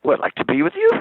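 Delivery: sudden disbelieving squeal of recognition; mock amazed, pitch shooting up; surprised barmaid. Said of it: "She goes".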